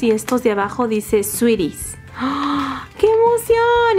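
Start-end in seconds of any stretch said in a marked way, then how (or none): 2.2-4.0 much higher thrilled cry; excited squeal. Real, not acted. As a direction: let the joy burst out. Opening a toy.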